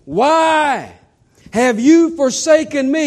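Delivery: high, slow anguished plea; anguished question. Acted. Jesus asking God from the cross, anguished plea. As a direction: ask it as a wounded plea.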